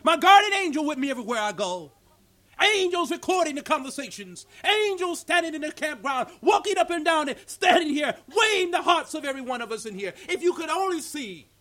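loud, high preaching at full force; fiery preaching. Acted. Preacher thundering to the congregation, loud and high. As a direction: preach it out to the campground.

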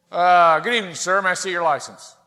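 a hint of a policeman's formal greeting; traffic cop. Acted police officer at the car.

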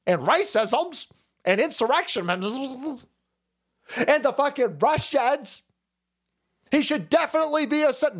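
high, hammed-up insistence; mock defenders' pleading. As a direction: plead the absurd case, pitch climbing as it goes on. Acted defenders pleading for senator.

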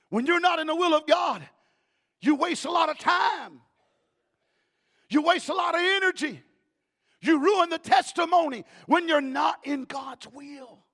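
loud, high preaching; full-force preaching. Acted. Preacher thundering at the church, loud and high. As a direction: thunder it to the congregation.

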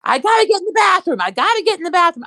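very high pleading whine; desperate sedated plea. Retold or imitated. Sedated brother to sister, begging for the bathroom, high whine. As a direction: whine it like a drugged patient.

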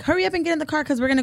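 high, very fast command; hurried ordering. Retold or imitated. Aunt rushing kids into the car, hurried ordering. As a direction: rattle out a mock order.

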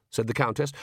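usual speaking voice

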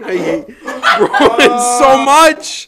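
high, slow laughing groan. Real, not acted. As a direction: laugh out the words in disbelief. Laughing through disgust.

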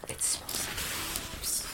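slow breathy ASMR whisper. Acted. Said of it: "I need to do my A S M R".

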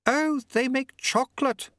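high, prim and polite; Lady Lejeune voice. A narrator's reading.

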